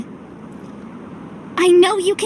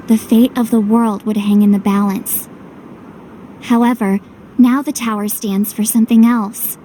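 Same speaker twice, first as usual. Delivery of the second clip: measured narrator voice; story narration; 3 semitones lower than usual. A narrator's reading. Hero looking back on the journey, measured narration. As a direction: tell the tale of the tower calmly.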